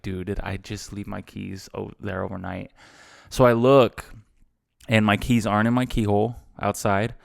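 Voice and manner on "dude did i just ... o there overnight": muttered to self. Retold or imitated. Panicked self-talk retold.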